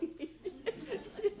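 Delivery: short airy laugh, amused; a quiet tickled giggle; breathy laugh. Real, not acted. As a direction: laugh under your breath at the joke. Wife laughs at the insult.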